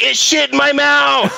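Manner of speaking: high, frantic cry down the phone; shrill panicked shout; panicked yell. Retold or imitated. Frantic 911 call, retold.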